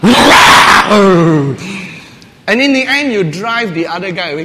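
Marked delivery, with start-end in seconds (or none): 0.0-1.8 long loud drawn-out bark; loud drawn-out woof; dog bark. Retold or imitated. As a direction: outbark the other dog. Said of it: "you go".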